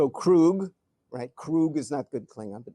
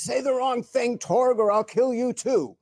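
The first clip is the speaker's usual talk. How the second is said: higher, louder villain threat; furious Klingon commander threatening his officer; angry threat; 8 semitones higher, 5 dB louder than usual. Retold or imitated. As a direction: snarl it at the underling. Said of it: "'Cause he's so angry".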